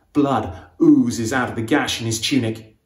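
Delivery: ordinary delivery, nothing marked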